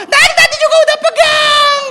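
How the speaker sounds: put-on very high, shrieked little-boy retort; indignant child. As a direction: yell back at dad in a toddler squeal. Son retorts to father.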